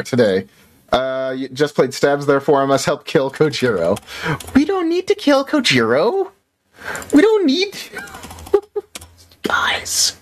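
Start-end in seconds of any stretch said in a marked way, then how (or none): 4.5-9.4 high squeaky panic plea; panicked pleading. Real, not acted. 9.4-10.2 whispered plea. Real, not acted. Whispered plea after laugh.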